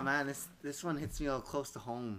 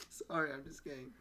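laughing; 3 semitones higher than usual. Real, not acted. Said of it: "No, I lost it".

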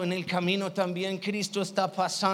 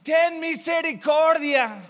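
slow, louder, higher desperate cry; louder shout; 5 semitones higher, 5 dB louder than usual. Acted. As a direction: stretch the plea out as a yell. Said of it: "gritó aún más fuerte".

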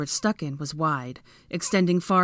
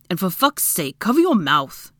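louder, exasperated command; irritated order; 2 semitones higher, 6 dB louder than usual. A narrator's reading. Draco to coughing Hermione, irritated. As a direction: build to a loud, cross order. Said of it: "Hermione merely coughed at the airborne debris".

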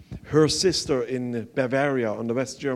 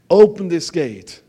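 a ringing, louder demand; loud speech quote; 4 semitones higher, 9 dB louder than usual. Retold or imitated. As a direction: proclaim it like the famous speech. Quoting Reagan's famous speech.

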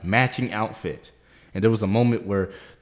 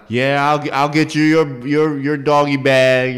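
imitated yelling; 5 semitones higher, 7 dB louder than usual. Waitress yelling across the room about a bag, imitated. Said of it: "she was just yelling across the damn room".

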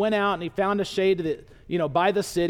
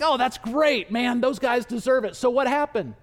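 higher, lightly hammed-up enthusiasm; eager approval; 4 semitones higher than usual. Jonah's friends relish the judgment news, eagerly. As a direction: cheer him on, keen to hear more.

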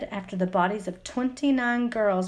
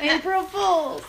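teasing; 7 semitones higher, much slower than usual. Retold or imitated. Retold prank reveal.

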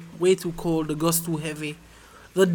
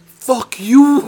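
loud, high playful curse; mock retort; 6 semitones higher, 10 dB louder than usual. To Bob who apologized, a loud playful curse. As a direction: snap it back at him in jest. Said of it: "I go".

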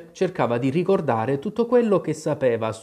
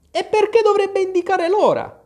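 high, loud, indignant grumbled question; grumbled retort; 6 dB louder than usual. A narrator's reading. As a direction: throw the question back, pitched very high. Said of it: "borbottò il cappellaio". (replica brontolata e stridula)

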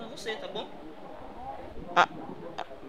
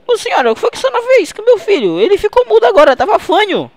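very high-pitched, wailing accusation; mock distress; 13 semitones higher, 6 dB louder than usual. Prank father blaming the healer for his mute son, mock distress. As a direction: play up a panicked parent's protest.

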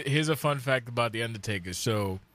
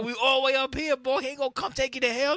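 higher, fast mock father voice; lightly voiced dad brushing off the kid's fear; 11 semitones higher, faster than usual. Dad reassuring his scared son, hammed-up voice. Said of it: "my dad's like".

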